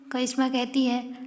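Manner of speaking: usual speaking voice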